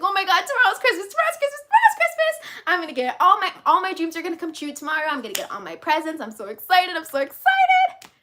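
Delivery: put-on a kid bursting with Christmas excitement; excited child. Speaker imitating a child the night before Christmas, squealing excited. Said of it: "like, so excited about Christmas".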